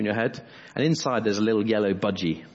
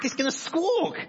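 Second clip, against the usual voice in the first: high squawking budgie voice. Acted. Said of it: "that's the best budgie impression".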